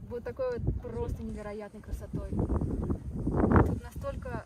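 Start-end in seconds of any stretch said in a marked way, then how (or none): 2.3-4.4 wind on mic. Real, not acted. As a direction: wind noise over the voice. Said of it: "Тут ветер, ничего не слышно будет".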